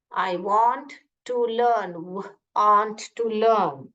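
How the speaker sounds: "I want to learn" drawn out very slowly, each word apart; slow repeat. Shown as a demonstration. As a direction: say it slowly back to the teacher. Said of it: "ऐसे बोलिए".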